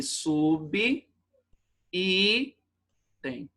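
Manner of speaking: "sub, i, tem" with the b closing the first piece; wrong syllable split. Shown as a demonstration. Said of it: "fica errado aqui".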